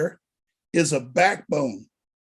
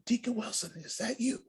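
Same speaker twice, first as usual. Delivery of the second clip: retold whisper; 6 semitones higher, 11 dB quieter than usual. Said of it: "Why are we whispering?".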